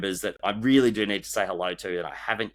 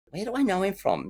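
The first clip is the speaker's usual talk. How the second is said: puzzled inner voice; 11 semitones higher than usual. Retold or imitated. Voicing shopper's puzzled thought.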